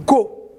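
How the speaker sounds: "go" with a hard G, as in "escargot"; hard G syllable. Shown as a demonstration. Teacher blends G and O for children, hard G. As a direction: sound out "go" as one hard-G syllable. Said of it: "Parce qu'on a G O".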